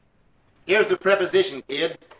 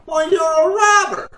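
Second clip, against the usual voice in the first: indignant shout; 10 semitones higher, 9 dB louder than usual. Acted. McGee yelling at the tree seller, outraged shout. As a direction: yell the insult high and loud. Trading insults over the price.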